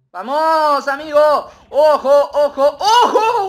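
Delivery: high, loud warning call; urgent shout. Real, not acted. Gamer warning his AI squad mid-fight, shouting. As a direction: yell a warning to your teammates.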